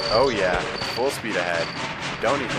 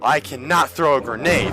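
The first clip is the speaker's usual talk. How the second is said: higher, louder exclamation; frustrated outburst; 4 semitones higher, 6 dB louder than usual. Real, not acted. Gamer failing to throw grenade, frustrated higher cry. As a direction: raise it into an exasperated cry.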